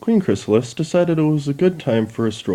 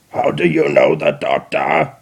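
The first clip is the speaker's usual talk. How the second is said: low, grating robot repeat; Dalek voice; 7 semitones lower, 3 dB louder than usual. Acted. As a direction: insist like a machine.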